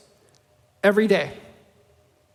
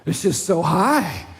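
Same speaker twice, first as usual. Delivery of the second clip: slow, lower, deflating complaint; mock grumbling downer; 3 semitones lower, slower than usual. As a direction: drag it down with a sour sigh. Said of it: "the negative friend replies".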